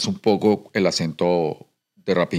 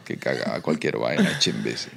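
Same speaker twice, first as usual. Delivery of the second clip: softly scornful, a pitch lower; low mock contempt; 8 semitones lower, 7 dB quieter than usual. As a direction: lower and softer, "cualquier vaina" muttered with disdain. Mutters the phrase contemptuously.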